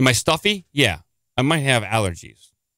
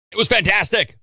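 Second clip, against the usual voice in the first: excited; 10 semitones higher, 4 dB louder than usual. Real, not acted. Enthusing about the museum.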